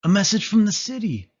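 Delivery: high and loud with urgent news; excited announcement. A narrator's reading. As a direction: rush to share the message. Old dog announcing the message.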